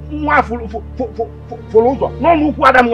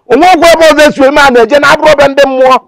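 loud, high, rapid-fire outburst; angry threat; 6 semitones higher, 7 dB louder, much faster than usual. Acted. Father warns he will lose his temper, angry and loud. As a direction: let the temper burst out in a rush.

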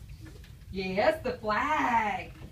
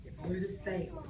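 put-on reading to children; 2 semitones lower, 6 dB quieter than usual. Teacher reading a picture book to the class, storybook voice. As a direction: draw the kids into the story.

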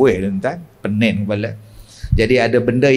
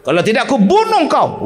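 much higher, mock threatening yell; hammed-up threat to kill the jinn; threatening healer; 10 semitones higher, faster than usual. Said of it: "perawat-perawat ni kasar".

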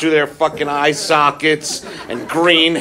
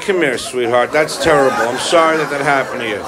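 mock sympathetic; 6 semitones lower than usual. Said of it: "You're like".

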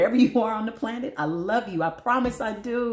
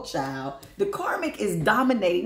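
ordinary delivery

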